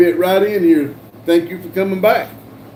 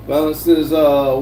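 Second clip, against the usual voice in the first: ordinary delivery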